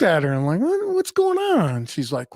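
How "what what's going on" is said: high, slow, put-on voice of mock surprise. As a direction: ham up the innocent surprise, pitched way up. Retold own reaction to wife.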